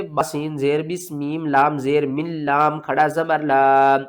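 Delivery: slow, measured sing-song spelling; spelling out syllables. Shown as a demonstration. Teacher showing how to read it, slow syllable drill. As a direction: spell each letter, then chant the joined word slowly. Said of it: "किस तरह पढ़ेंगे?".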